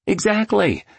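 high, delighted burst of agreement; applauding approval. A narrator's reading. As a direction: cheer the point on, pitched high. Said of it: "applauded Mister Riley".

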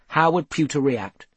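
ordinary delivery

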